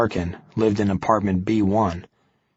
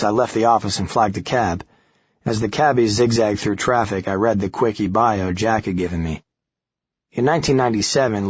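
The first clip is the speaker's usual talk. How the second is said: usual speaking voice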